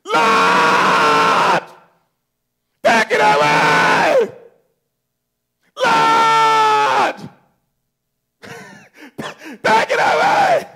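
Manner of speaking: high, drawn-out desperate shout; anguished cry to God. Acted. Believer in trouble crying to God, anguished shout. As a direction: cry out loudly to heaven, stretching the words. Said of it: "What do we say?".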